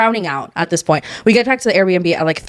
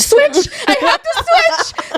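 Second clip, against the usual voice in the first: climbing from high to very high and slow, laughing; mock desperate wail; much slower than usual. Stuck in the plane bathroom, wailing between heaves. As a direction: stretch it out like you can't hold it in. Retold airplane bathroom vomiting.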